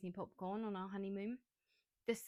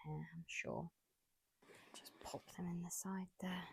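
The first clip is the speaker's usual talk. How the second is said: whisper; 5 dB quieter than usual. Real, not acted. Aside while packing.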